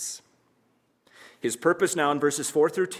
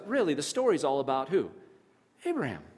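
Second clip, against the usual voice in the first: usual speaking voice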